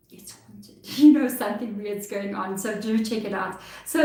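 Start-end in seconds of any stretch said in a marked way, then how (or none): 0.1-0.8 breathy, hushed spooky aside; spooky whisper. Pretend. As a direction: whisper it like a ghost story. Spooky punchline after cheap place.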